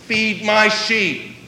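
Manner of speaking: Feed my sheep, drawn out slowly with weight on my; preacher driving Jesus's command home, slow and firm; slow emphatic. Shown as a demonstration. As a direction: lay each word down slowly and firmly. Repeats command, slowed for emphasis.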